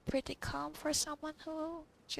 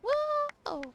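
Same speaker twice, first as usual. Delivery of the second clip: excited shout; 14 semitones higher, 8 dB louder than usual. Real, not acted. Excited reaction to the dance.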